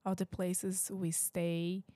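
ordinary delivery

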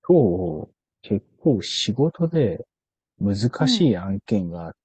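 slow, drawn-out careful delivery; very slow speech. Shown as a demonstration. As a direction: speak each phrase deliberately slowly. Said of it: "ゆっくり話しながら終わりますか". (ゆっくり話す)

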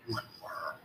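very soft, voiceless whisper. A narrator's reading. Tom standing his ground, answering in a whisper. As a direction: barely breathe the words out. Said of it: "whispered Tom, standing his ground".

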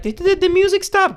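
very high mock confused voice; imitating the lady. Lady at the show asking if the music stopped, very high. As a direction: squeak it out like a puzzled lady.

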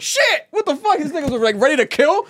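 high-pitched, loud incredulous outburst; hyped retold shock, voice shooting up; shocked disbelief. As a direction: blurt it out in amazed disbelief. Retelling his own shocked reaction.

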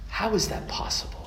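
whispered in wonder; awed whisper. Acted. Preacher asking congregation about the miracle, awed whisper. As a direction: breathe the question in awe.

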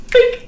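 bink sound effect. Pretend. Ice sculpture vanishing sound.

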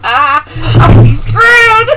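loud exclamation. Real, not acted. Laughing dread at the joke.

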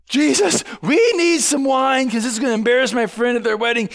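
high-pitched mock panic; hammed-up desperate request; urgent plea. Acts out Mary's urgent plea.

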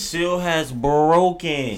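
high, loud and drawn out; mock grand announcement. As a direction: proclaim it slowly like a momentous event. Mock announcement of needing to pee.

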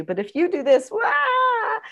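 shouted fake whine of a small child; fake tantrum wail. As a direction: ham up a pretend tantrum cry. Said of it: "you fake a tantrum".

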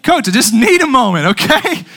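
loud, high protest; protesting plea. Retold or imitated. Winded player arguing with coach, loud protest. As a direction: plead loudly with the coach, exasperated.

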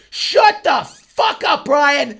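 high, drawn-out yell at a heckler; slow, high-pitched mock scream; retold angry yell. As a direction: imitate a fed-up audience member erupting. Crowd member's outburst at heckler, retold.

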